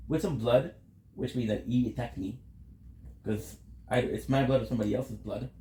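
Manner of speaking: ordinary talk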